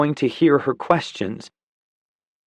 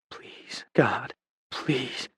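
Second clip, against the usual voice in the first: desperate whispered plea; whispered prayer; 8 dB quieter, much slower than usual. Acted. Dawson prays over injured London, whispering. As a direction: breathe the prayer.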